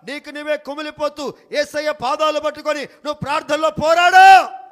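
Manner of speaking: loud, driving sermon that peaks on the last word; fervent preaching. Acted. Pastor urging night prayer, fervent loud preaching. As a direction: preach it hard to the crowd.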